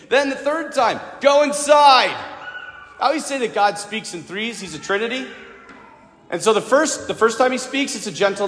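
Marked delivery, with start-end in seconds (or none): 1.2-2.1 louder, higher shouted "Go inside"; commanding call. Retold or imitated. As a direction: call out like an order that won't be ignored. Third and final call to go inside.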